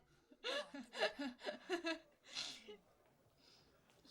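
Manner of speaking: long delighted laugh, almost crying. Real, not acted. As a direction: burst out laughing until breathless. Reacting to funny show scene.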